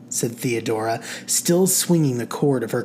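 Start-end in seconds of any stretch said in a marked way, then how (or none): none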